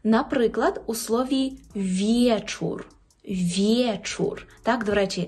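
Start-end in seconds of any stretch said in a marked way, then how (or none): 1.8-4.5 вєчур as vye-chur; clear ye. Shown as a demonstration. Teacher drills an example, ye in вєчур. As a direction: say вєчур with glide after v. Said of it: "маємо повне право читати як Є".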